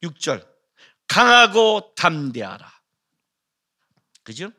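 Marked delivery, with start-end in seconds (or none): 1.1-2.7 slow, drawn-out "강하고 담대하라"; emphatic command. Acted. Preacher quoting verse six to show its emphasis, emphatic "강하고 담대하라". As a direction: hit "강하고" hard and stretch "담대하라". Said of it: "강조되는 게 나와요".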